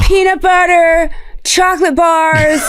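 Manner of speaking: slow, high, drawn-out product list; Rob Lowe. Retold or imitated. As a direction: stretch each item like a gushing ad pitch. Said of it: "his Rob Lowe impression".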